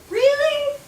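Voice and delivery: over-the-top incredulous. Retold or imitated. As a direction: squeal of mock disbelief at the source. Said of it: "where I was like".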